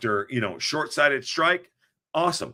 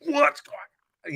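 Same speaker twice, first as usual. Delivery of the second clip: mock voice; 13 semitones higher than usual. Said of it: "I'm trying to not be like".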